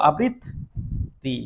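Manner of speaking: আবৃত্তি said slowly and softly with a doubled ত; soft slow demo. Shown as a demonstration. Teacher softly modelling আবৃত্তি for the class. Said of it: "দুইবার ত আমরা আলাদা লিখবো".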